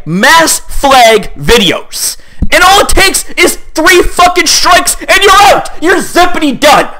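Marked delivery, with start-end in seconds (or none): 2.5-6.9 real indignant outburst, pitched high; heated rant. Real, not acted. Ranting about the three-strike rule.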